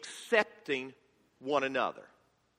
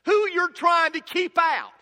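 much higher and louder, pressing demand; pleading Donkey; 11 semitones higher, 5 dB louder than usual. Retold or imitated. Pastor voicing Donkey pressing Shrek during their argument. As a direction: push him to confess who. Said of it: "Donkey says".

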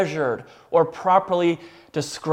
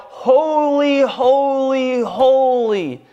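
ringing angelic acclamation, slowing to a close; crying out; slower than usual. Retold or imitated. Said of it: "They are crying out".

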